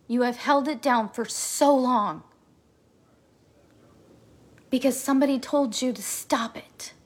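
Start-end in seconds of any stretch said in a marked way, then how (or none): 4.7-6.9 breathy hushed whisper, as if sharing a secret; whispered aside. Real, not acted. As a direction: drop to a whisper to confide.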